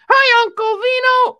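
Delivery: very high, loud puppet voice; high-pitched cartoon greeting; Marmalade. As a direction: squeal it out as an eager little puppet friend. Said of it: "This here is my good friend".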